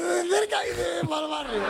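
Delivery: remate agudo y a gritos; gritado. Retold or imitated. Repite el chiste gritando. (remate gritado, mucho más agudo)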